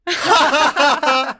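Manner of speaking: hearty laugh; group laughter. Acted. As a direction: burst out laughing. Friends laugh.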